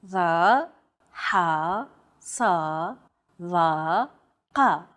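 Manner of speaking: "Va, ha, sa, va, ka" deep in a constricted throat; tense the throat on each of "Va, ha, sa, va, ka", pausing between them; separate, throaty Arabic syllables "Va, ha, sa, va, ka"; emphatic consonants. Shown as a demonstration. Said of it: "much more tense because the throat is constricted".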